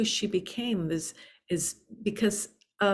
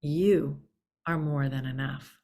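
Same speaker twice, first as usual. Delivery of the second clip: YOU set apart with a pause, then "are more than enough" lower; deliberate, spaced-out "You ... are more than enough"; said to land; 3 semitones lower than usual. Real, not acted. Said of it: "say it again 'cause I want it to land".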